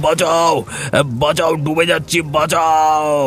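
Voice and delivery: desperate high calls for help; crying for help. Acted. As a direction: scream for help while drowning. Drowning man cries for help. (সাহায্যের জন্য আর্তনাদ)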